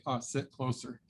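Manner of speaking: ordinary talk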